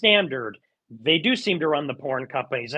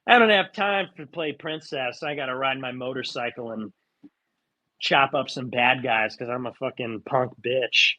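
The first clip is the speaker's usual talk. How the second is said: lightly voiced tough action heroine, lower; mock tough heroine; 6 semitones lower than usual. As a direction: sneer it like a cocky film heroine. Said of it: "She's like that boss bitch".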